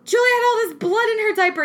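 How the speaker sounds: high, loud, fast alarmed report; urgent panic. Retold or imitated. Mother telling her husband, high loud panic. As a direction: rush it out in panic.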